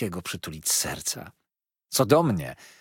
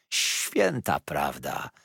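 slow repetition; 6 semitones lower, much slower than usual. A narrator's reading. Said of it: "powtarzał".

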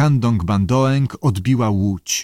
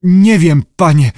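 loud, raised rasp of fear; frightened diver's strained answer; 6 semitones higher, 8 dB louder than usual. A narrator's reading. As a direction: croak it out desperately, pitched up. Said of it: "chrypiał Syngales".

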